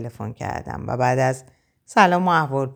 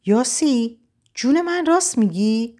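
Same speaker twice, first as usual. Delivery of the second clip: much higher, amazed question; stunned disbelief; astonished; 8 semitones higher than usual. A narrator's reading. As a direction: friend gasping is it true. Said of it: "با تعجبی که از تون صداش پیدا بود".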